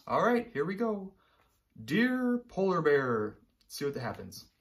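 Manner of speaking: usual speaking voice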